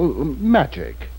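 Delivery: ordinary delivery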